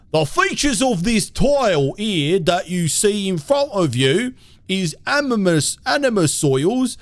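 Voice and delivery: put-on high, loud-edged British caricature accent; mock British accent. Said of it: "accept the accents".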